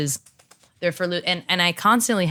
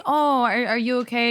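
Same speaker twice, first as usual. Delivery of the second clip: concerned; 8 semitones higher than usual. Retold or imitated. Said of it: "when someone's like".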